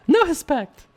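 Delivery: very high-pitched impression; Anderson Silva. Streamer showing viewers his favorite impression, high put-on voice. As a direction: do a comically high falsetto impression. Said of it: "I like the Anderson Silva one".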